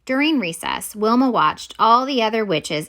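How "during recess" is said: plain reading voice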